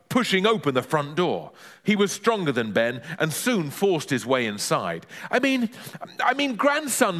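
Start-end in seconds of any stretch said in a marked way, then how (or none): 5.3-7.2 high, nervous stammer; backtracking boy, high-pitched; flustered correction. Acted. Boy retreats from neighbour correcting himself, high nervous voice. Ben hastily corrects his lie.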